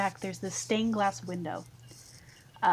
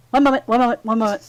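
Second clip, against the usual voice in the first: loud hurried repeat; 3 semitones higher, 12 dB louder, much faster than usual. Acted. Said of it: "He's just like".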